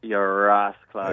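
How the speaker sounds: slow, drawn-out Jamaican accent. Shown as a demonstration. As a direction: stretch it out in a Jamaican patois. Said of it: "that's my Jamaican accent".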